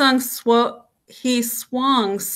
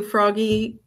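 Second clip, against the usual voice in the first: ordinary delivery, nothing marked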